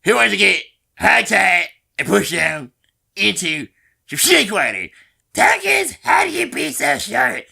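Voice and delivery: squeaky cartoon teen girl, shouting jabs; Teen Girl Squad voice. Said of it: "Teen Girl Squad voice".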